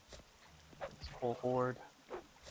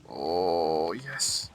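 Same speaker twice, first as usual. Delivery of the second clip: loud relieved oh yes; real relief, louder; relieved cheer; 5 semitones lower, 9 dB louder than usual. Real, not acted. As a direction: celebrate reaching land out loud. Relief reaching land.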